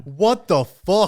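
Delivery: loud, stretched-out astonishment; slow, loud shocked outburst; real disbelief. Real, not acted. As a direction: drag it out, appalled. Shocked at company turnover.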